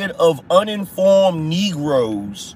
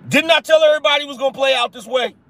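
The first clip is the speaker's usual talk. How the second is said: raised, emphatic; 7 semitones higher, much faster than usual. Shown as a demonstration. Repeats his own claim.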